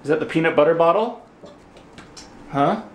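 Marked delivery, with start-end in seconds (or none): none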